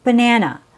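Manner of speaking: banana said with weak first and last syllables, stressed middle; model word banana. Shown as a demonstration. Teacher modelling banana with schwas. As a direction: say banana as buh-NA-nuh. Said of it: "Our first example is banana".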